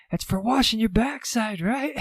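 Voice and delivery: calling up. Retold or imitated. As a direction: call out to her way up in the hotel. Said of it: "he's like".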